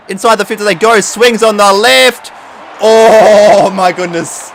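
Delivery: loud, high commentator shouting; high yell, key words stretched out slowly; excited play-by-play. Real, not acted. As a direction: call the play at full volume. Excited play-by-play of own game.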